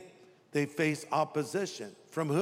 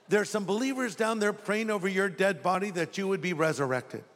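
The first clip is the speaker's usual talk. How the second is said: ordinary talk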